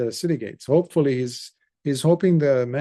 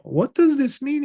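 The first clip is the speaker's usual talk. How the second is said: much higher, urgent question; alarmed king; 12 semitones higher, 4 dB louder than usual. Acted. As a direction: demand the meaning in a panic. King demands an answer.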